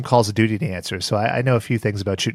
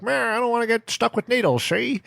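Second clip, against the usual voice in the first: put-on much higher whiny kid voice; imitating Louis; 10 semitones higher, 3 dB quieter than usual. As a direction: whine like a scared cartoon kid. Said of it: "and he's like".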